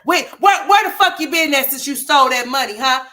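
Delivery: loud, high, demanding where she's been; shouting demand. Real, not acted. Commenter yelling at the vlogger about the money, loud demand. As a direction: yell the question right at her.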